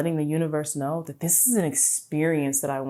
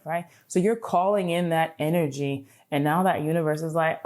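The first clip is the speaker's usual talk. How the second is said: usual speaking voice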